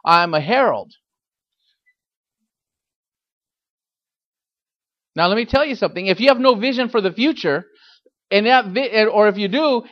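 preaching hard to the church; fiery sermon, rising and pushing; fiery preacher sermon. Acted. Preaching at full force.

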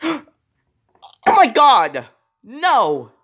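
loud, high, drawn-out pretend horror; gasp, then cry out slowly; horrified cry. Toy character finds school gone, horrified cry.

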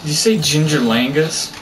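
ordinary talk

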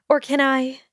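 rushed, high and eager question; student itching to go. A narrator's reading. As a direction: hurry it out, bubbling. Eager to leave.

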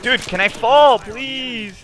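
exasperated yell. Real, not acted. Player frustrated at gatekeeping.

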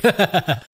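amused little laugh. Real, not acted. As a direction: chuckle briefly after your own remark. Interviewer laughs after own joke.

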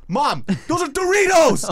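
high, very loud exasperated shout; screaming son. Retold or imitated. Son correcting his mom's word, screaming. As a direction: imitate a son yelling the correction. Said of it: "they're screaming at each other".

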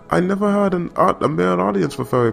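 high, put-on British accent. Quoting the author in a mock British accent. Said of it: "I'm trying to make a British accent".

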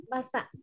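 "Basa" quietly, with a plain voiced b; soft, unaspirated b opening "Basa". Shown as a demonstration. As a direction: say "Basa" softly with no puff of air. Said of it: "từ này không bật hơi".

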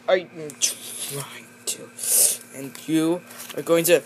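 ordinary talk, nothing marked

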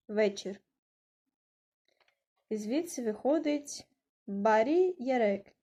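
usual speaking voice

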